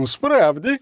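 cheeky doubting question; skeptical. Acted. Chemistry professor questioning the claim, skeptical. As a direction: doubt it, a bit insolently. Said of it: "зухвало і досить скептично запитав".

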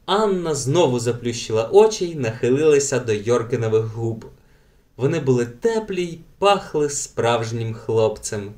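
usual speaking voice

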